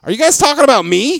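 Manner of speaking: high, loud, fast challenge; confronting. Retold or imitated. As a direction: confront the whisperers indignantly. Confronts whispering coworkers, acted.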